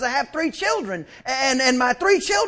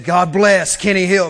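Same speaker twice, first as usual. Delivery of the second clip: louder repeat; 6 semitones lower, 4 dB louder than usual. Real, not acted. Preacher repeating the blessing louder to the congregation, louder repeat. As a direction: say it again with more push. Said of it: "I said".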